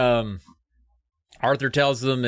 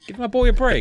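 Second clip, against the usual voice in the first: mock defensive dad; 11 semitones higher, 5 dB louder than usual. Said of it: "It's like".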